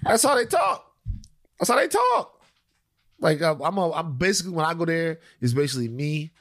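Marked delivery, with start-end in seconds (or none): 0.0-0.9 insisting. Real, not acted. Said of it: "insisting to co-host". 1.6-2.3 pitched way up, insisting; high insistence. Real, not acted. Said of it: "insisting to co-host".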